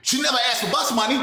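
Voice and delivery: ordinary talk, nothing marked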